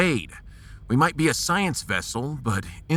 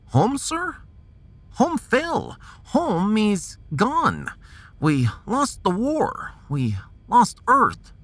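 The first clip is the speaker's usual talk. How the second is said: put-on a higher Russian-accented voice, slow and mournful; 9 semitones higher, slower than usual. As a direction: tell the captain that home is gone, slowly. Russian officer grieves lost Earth.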